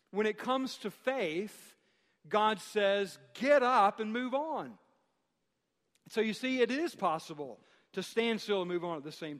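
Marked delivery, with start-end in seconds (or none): 3.3-4.8 emphatic command. Retold or imitated. God's command, louder.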